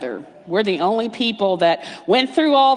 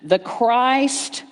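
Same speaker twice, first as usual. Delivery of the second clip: confident affirmation; 4 semitones higher, 3 dB louder, slower than usual. Retold or imitated. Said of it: "some of the people are going".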